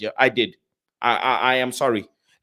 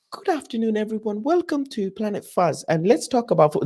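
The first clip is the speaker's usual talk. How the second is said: mock formal presenter; 6 semitones higher, 3 dB quieter than usual. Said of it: "That's not me".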